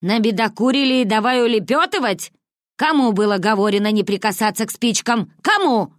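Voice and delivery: stern questioning, rising at the end; acted granny's reprimand; grandmother scolding. A narrator's reading. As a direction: tell off naughty children, mildly heated.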